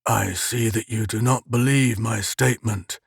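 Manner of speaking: a lightly voiced uncanny captor; drawling peculiar voice. A narrator's reading. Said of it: "that drawling, peculiar voice".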